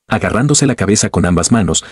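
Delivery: usual speaking voice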